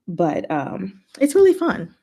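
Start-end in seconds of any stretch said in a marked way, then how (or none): 1.2-1.9 excited burst. Real, not acted. Burst of enthusiasm.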